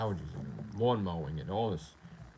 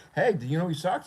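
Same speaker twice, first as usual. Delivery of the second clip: higher mock taunt; mock troll; 8 semitones higher, 4 dB louder than usual. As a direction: call out like a gloating troll.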